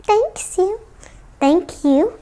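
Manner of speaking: ordinary delivery, nothing marked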